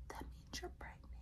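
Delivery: barely audible whisper. Retold or imitated. Clinic lady telling her the result, whispered. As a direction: break the news in a tiny whisper. Acting out lady breaking the news.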